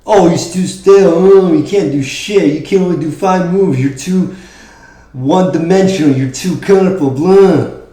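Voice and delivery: high jeering mock voice; mocking cynics. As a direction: sneer like the doubters. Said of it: "cynics who told you".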